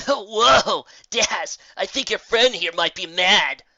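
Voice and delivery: a cocky griffin, teasing and loud on the whoa; lightly voiced griffin. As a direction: needle the angry pony, half amused. Griffin character voice.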